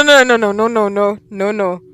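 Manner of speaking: ordinary talk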